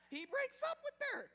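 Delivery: high, loud incredulous exclamation; outraged disbelief. Retold or imitated. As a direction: cry it out as a scandalous reveal. Shock reveal of the breakup.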